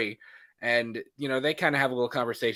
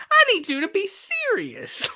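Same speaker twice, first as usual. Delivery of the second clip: mock wife voice; 3 dB louder than usual. Wife pleading in retold scene.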